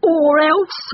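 loud, high barked threat; fierce dog's challenge. A narrator's reading. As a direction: bark it like an angry dog. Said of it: "he barked".

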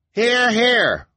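very high, loud cheer; crowd cry. A narrator's reading. Townspeople back the elder, loud cry of agreement. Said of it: "cried several voices scattered in the crowd".

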